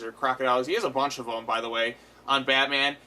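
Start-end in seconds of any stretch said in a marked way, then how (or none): none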